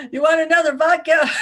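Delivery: loud, high hammed-up merriment; boisterous mock toast through laughter; laughing. Imagined operative offering a comrade vodka, laughing. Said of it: "go home at night laughing".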